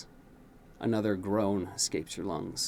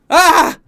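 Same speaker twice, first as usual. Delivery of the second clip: much higher, far louder terrified scream; 21 dB louder than usual. A narrator's reading. Prince waking from a nightmare, terrified scream. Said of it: "You begin to scream".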